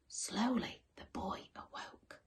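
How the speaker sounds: hushed storyteller whisper. A narrator's reading. Narrator whispers as the boy wakes. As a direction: breathe it out softly.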